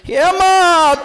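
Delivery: high, loud call to mother; pleading cry. Acted. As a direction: burst out calling for mother. Son pleads with unanswering mother.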